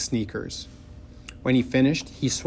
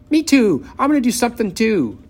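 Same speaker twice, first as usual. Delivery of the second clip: put-on much higher, louder, fast child's whine; shrill little girl; 12 semitones higher, 7 dB louder than usual. A narrator's reading. Little sister begging to join, shrill fast whine. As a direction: squeal to be included. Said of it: "Maybelle shrilled".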